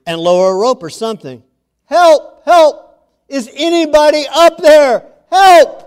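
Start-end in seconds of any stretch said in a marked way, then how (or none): 1.8-5.8 high, loud desperate cries for help; man dangling from a cliff yelling for help; shouting for help. Acted. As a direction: yell up the cliff for rescue. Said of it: "Jack began yelling for help".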